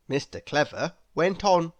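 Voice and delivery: plain reading voice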